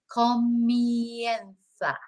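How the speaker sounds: Comienza said slowly, syllable by syllable, with a Spanish lisped z; careful Spanish. Shown as a demonstration. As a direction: model Comienza slowly for learners. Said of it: "Remember syllables to start with".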